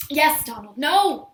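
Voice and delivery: loud, short outburst, rising on "no"; exasperated. Acted. To Donald who keeps interrupting, exasperated. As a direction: cut him off loudly.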